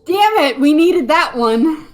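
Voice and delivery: frustrated. Real, not acted. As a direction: groan in frustration. Missed crit frustration.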